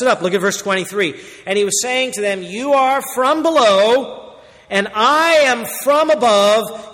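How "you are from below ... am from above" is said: slow, high, loud pronouncement of judgment; solemn proclaimed warning. Acted. Preacher voicing Jesus warning proud hearers, slow and loud. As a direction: boom out each phrase slowly like a prophet.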